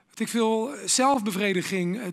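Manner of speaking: usual speaking voice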